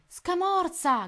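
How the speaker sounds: high, loud call of a name; urgent shout. A narrator's reading. Tony calling his friend amid the pirates, urgent shout. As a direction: yell it across the deck. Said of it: "gridò Tony".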